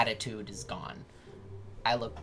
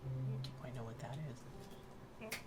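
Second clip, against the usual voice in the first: hushed; 15 dB quieter than usual. Acted. Player's character answers deity, hushed.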